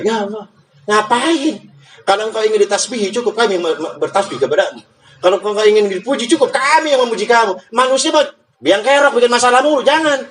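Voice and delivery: high, fast complaining angel voice; protesting angel. Acted. Angels complaining to God about creating humans, high and whiny. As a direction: whine the objection up high, half laughing.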